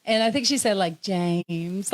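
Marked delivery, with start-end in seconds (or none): 1.0-1.9 "James" stretched long, lower and softer; JAMES dragged out. Retold or imitated. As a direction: draw out the vowel of "James" lovingly. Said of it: "she drug it out and just made it so beautiful".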